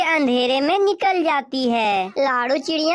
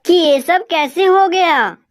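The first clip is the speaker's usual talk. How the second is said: louder wife crow, baffled; Gori crow, puzzled; 12 dB louder than usual. Acted. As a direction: play the confused mother crow loud. Narrator voices Gori crow. (हैरान कौवी की ज़ोरदार आवाज़)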